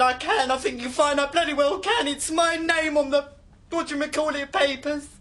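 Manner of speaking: heated, high and loud insistence; worked-up, defiant arguing; angry retort. Acted. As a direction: stand your ground, voice climbing in outrage. Row over who keeps the house.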